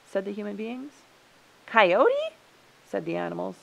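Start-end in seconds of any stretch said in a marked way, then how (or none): 1.7-2.3 high, loud "Coyote?" rising; raised pitch and volume, questioning; surprised echo. A narrator's reading. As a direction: echo the name in disbelief.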